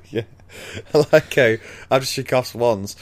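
ordinary talk

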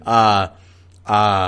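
loud, stalled worker's "uh"; stall, lost for an answer; reluctant hesitation. Acted. Worker asked to chop branches, loudly hesitant.

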